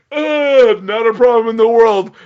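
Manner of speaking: high and loud, beaming gratitude; excited. Pretend. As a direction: gush it with big cheer. Playful thanks to Australians.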